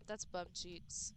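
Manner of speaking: That's bum cheeks. dropped low and soft, slowly; quiet grumble. Real, not acted. Streamer repeating the complaint to themself, soft and slow. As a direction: let it sink into a soft defeated grumble.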